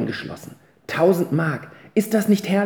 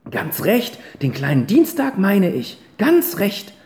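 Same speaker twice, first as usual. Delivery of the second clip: stern grandma scolding her cadets, raised voice; grandmother's speech; 6 semitones higher than usual. Acted. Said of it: "sagte die Großmutter".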